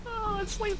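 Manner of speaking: very high nervous squeak; squeaky nervous. Real, not acted. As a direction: back away in a high voice. Creeped out by blood goblet.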